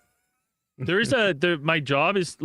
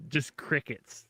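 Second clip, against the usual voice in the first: usual speaking voice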